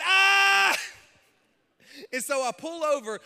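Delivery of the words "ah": long, high, loud scream; panicked scream. Retold or imitated. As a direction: scream at full volume in mock panic. His panicked scream as car lurches.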